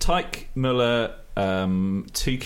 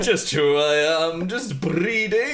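slow, lofty and much higher, drawn out; hammed-up posh aristocrat; 6 semitones higher, 3 dB louder, much slower than usual. Mocking his own posh voice as he answers his guest. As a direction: play the snob bragging about breeding. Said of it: "How did you end up so posh?".

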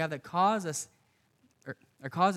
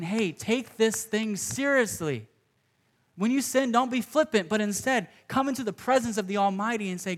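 urgent exhortation; 6 semitones higher, 5 dB louder than usual. Acted. Paul urging the church not to be flippant, loud and higher. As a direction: plead with them to take it seriously.

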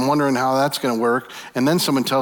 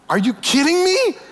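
high, loud incredulous cry; disbelieving outburst; 3 dB louder than usual. Acted. Preacher mocking his own shock at the advice, incredulous shriek. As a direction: throw the line up in disbelief, pitched way up.

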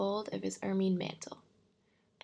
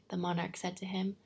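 plain reading voice

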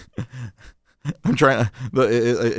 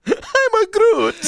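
very high, slow mock Italian accent; Vin Diesel; 14 semitones higher, much slower than usual. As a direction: squeak it slowly in silly Italian-accented English. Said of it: "Give me Vin Diesel".

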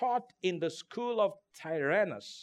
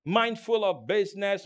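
usual speaking voice

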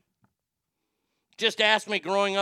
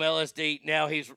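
usual speaking voice

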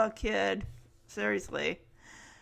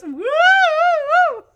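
loud, high drawn-out woo; imitated audience whoop; 10 dB louder than usual. Studio audience reacting to the flirting, imitated whoop. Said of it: "Audience just goes".

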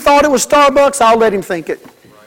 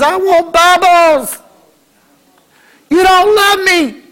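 put-on high, slow, drawn-out child's whine; whiny child; 7 semitones higher, slower than usual. Child in the store whining at the parent, slow high whine. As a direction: whine like a spoiled kid denied a toy.

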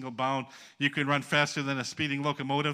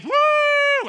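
high mock revival whoop; shrill-free loud mock woo; 14 dB louder than usual. Mocking hyped-up faith talk.

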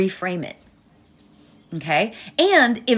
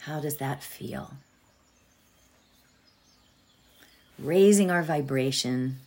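fading to a hushed whisper; soft whisper; 4 semitones lower, 7 dB quieter than usual. Acted. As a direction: let the mantra drift into a whisper. Meditation fades into whispered mantra.

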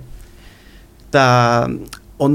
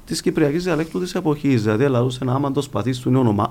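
normal talking voice

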